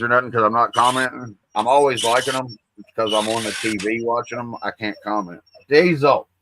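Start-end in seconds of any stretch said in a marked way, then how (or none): 5.7-6.3 sharp call to the dog, louder and higher. Real, not acted. Owner calling out to his barking dog, sharp. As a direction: bark the dog's name to stop him.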